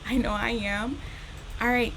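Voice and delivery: ordinary delivery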